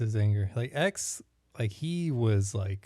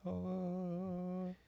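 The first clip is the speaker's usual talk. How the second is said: awed hush; 8 semitones higher, 13 dB quieter than usual. Retold or imitated. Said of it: "whenever they show that it's like".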